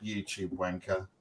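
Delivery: slow, low, quiet put-down; imitated eye-rolling scorn. Wife after fan greets him, imitated muttered eye-rolling contempt. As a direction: mutter it with withering disdain. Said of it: "rolls her eyes and just goes".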